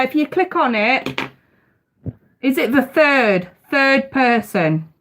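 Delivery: normal talking voice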